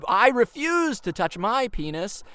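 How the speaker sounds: high, loud and slow, every word pushed; mock yell. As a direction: yell the punchline to be heard. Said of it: "'cause I yelled".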